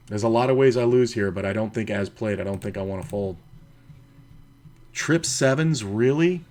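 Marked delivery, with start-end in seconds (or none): 4.9-6.4 loud, high disbelief; incredulous. Real, not acted. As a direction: react in real disbelief at the cards. Disbelief at opponent's hand.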